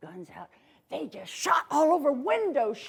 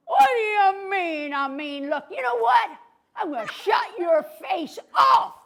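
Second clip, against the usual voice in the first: mock angry cowboy; 5 semitones higher, 4 dB louder than usual. Mean cowboy threatening Larry, loud and hammy. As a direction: ham up a villain's growling threat.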